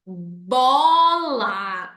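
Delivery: "bola" drawn out slowly, sound by sound; sounded-out word. Shown as a demonstration. Teacher showing parents the phonic method, sounding out "bola". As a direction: stretch every sound in "bola". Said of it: "faz os sons de cada palavra".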